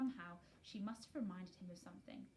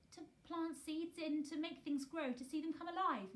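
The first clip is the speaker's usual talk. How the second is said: faltering; 7 semitones higher, 5 dB louder than usual. A narrator's reading. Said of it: "Mary faltered".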